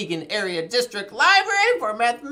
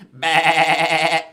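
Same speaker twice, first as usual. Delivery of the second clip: loud, lower, held bleat; sheep-like bleat; 5 semitones lower, 6 dB louder than usual. Pretend. Host attempts farm song's goat, sheep-like bleat. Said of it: "was that a sheep or a goat?".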